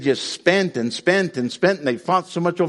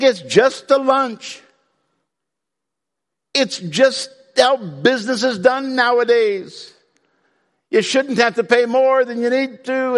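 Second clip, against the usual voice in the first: mock excuse-making; 9 semitones higher than usual. Preacher voices someone excusing their own path, high mock innocence. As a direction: brush it off in a high put-on voice.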